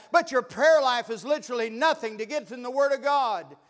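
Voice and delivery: loud, high preacher's cry; full-force preaching. Acted. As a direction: preach it out to the back pew.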